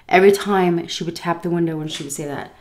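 normal talking voice

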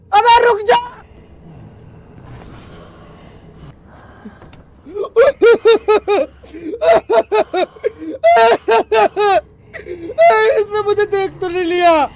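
high loud shriek dissolving into crying laughter; hysterical laughing scream. Real, not acted. Gamer caught by the ghost, hysterical laughing scream. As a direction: scream half laughing half sobbing.

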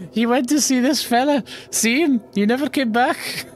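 very high put-on brogue; high crone, insistent and hammed-up; leprechaun-like old lady. Chicken lady showing who her brother visited, high voice. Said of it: "chicken lady leprechaun".

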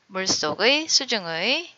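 usual speaking voice

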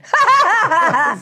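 loud, high cackle; high-pitched belly laugh. Real, not acted. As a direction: burst out laughing. Laughs at the angel line.

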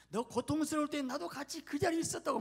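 very high, loud preaching cry; Jesus' impassioned voice. Acted. Jesus tells the sufferer he was there, impassioned. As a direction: pour out fervent solidarity at full voice. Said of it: "말하고 있는 거예요".

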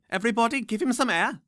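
put-on high, bossy old witch. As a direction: shoo the crowd back. Witch orders crowd back.

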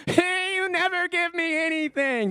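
put-on high whiny child voice; whining toddler. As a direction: imitate a toddler complaining to dad. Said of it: "That's what I hear right there".

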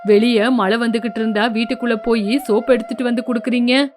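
resentful son. Acted. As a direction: throw the blame back at his mother. Son mocks mother.

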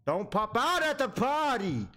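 high, slow pleading whine; nervous plea. Real, not acted. Player begging game not to scare him, high slow plea. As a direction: beg the monster not to jump out.

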